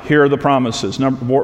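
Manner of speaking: usual speaking voice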